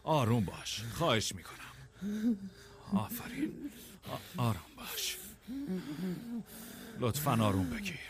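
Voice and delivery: softened, pleading calm; soothing. Acted. Begging Oswald to calm down, soothing. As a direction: talk someone down gently. Calming the distraught man down.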